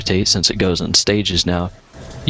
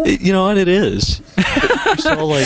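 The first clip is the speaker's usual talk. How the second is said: higher, laughing through the words; 13 semitones higher than usual. Real, not acted. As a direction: laugh while you talk. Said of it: "is this geezer radio here?".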